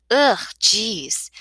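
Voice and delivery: loud, exasperated disgust; disgusted groan. Acted. Girl finding paint on her palms, disgusted. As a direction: let the irritation burst out on the first word.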